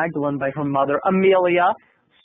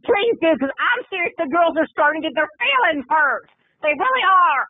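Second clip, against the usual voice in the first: much higher, pleading dancer voice; mock pleading dancer; 10 semitones higher than usual. Dancer pleads with Terry about the hurt girls, high and whiny. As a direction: beg him to fix it, way up high.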